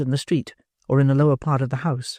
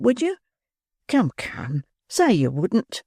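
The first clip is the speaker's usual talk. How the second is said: higher, tender woman's voice; 7 semitones higher than usual. A narrator's reading. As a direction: soften it with sweetness. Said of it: "with the touch of woman's tenderness".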